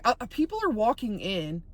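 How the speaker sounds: normal talking voice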